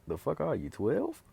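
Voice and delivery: incredulous squeak climbing way up high on twelve; he mocks her as a child, shrill disbelief; mocking disbelief. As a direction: scoff at her like she's a kid. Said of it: "And he's like".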